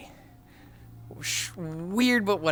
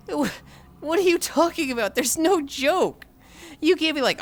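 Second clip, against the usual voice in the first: high-pitched, incredulous protest; exasperated; 10 semitones higher than usual. Real, not acted. Streamer disputing a chatter's missed-joke claim, exasperated. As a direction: argue back, pitch jumping up.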